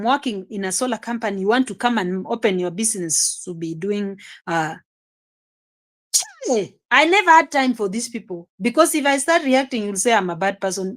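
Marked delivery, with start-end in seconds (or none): 6.1-6.7 sharp, high-pitched "Chai" of disbelief; high exclamation of disbelief. Real, not acted. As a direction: throw up your voice in shock.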